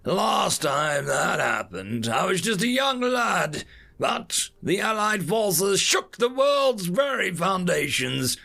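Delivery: put-on high-pitched old man holding forth with defiance; feisty old veteran. Old veteran warns alien captor about humanity, feisty and defiant.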